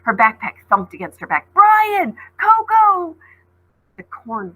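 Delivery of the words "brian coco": high, loud panicked shouts; frantic shouting while running; shouting names. Acted. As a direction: yell for friends while running. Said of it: "calling her friends' names".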